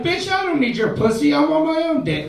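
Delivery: high, laughing brag; mock rapper boast. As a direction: throw the lyric back at her. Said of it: "I was like".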